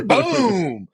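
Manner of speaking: high loud whistle dropping into a boom; imitated crash noise. Hunter mimicking luck crashing on camera, high whistle and boom. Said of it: "your luck goes".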